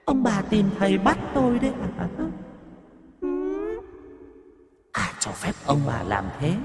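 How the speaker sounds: high, eerie possessed woman, menacing; ghost voice. Acted. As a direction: threaten them as the vengeful ghost. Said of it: "mà cất tiếng". (giọng ma nhập, cao, rợn, đe dọa)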